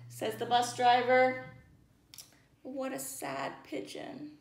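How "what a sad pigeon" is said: soft pity. Real, not acted. Pity for pigeon.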